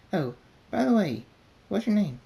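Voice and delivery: high, bubbly, fast-talking party pony; excitable Pinkie Pie. A narrator's reading. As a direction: gush like you can't wait to throw a party. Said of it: "she says".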